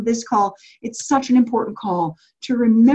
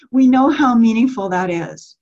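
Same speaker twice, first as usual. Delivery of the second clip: normal talking voice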